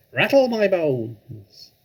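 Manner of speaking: high, slow, eerie parrot croak; spooky parrot voice. Acted. Parrot showing off its spooky voice, high and slow. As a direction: stretch it out like a haunted bird. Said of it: "a wonderful imitation of a spooky voice".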